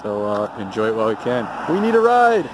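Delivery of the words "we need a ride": loud high call for help; shouting. Real, not acted. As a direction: yell it to the street. Calling out, lost.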